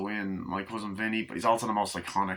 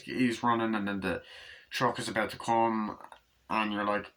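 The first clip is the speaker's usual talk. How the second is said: ordinary delivery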